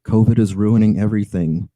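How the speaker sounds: ordinary talk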